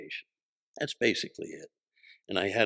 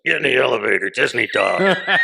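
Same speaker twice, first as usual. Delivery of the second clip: loud imitation of mom, last words stretched; mom's blunt delivery; 2 semitones higher, 11 dB louder than usual. Shown as a demonstration. Demonstrating how mom delivers bad news, loud blunt delivery. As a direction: shout it like mom's order, drawing out the ending. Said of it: "that's how my mom delivers stuff".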